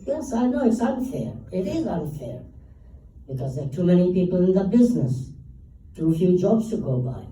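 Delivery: retold emphatic protest. Actor retells his answer about unfair downsizing, emphatic.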